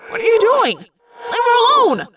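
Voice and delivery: high, loud, alarmed yell; Marco. A narrator's reading. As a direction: yell to make him stop. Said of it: "Marco yelled".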